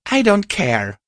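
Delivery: high-pitched, indignant huff; huffy. A narrator's reading. Friend refuses to be brushed off, huffy. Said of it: "I huffed".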